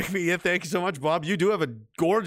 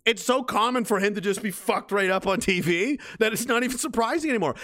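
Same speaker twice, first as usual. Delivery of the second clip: usual speaking voice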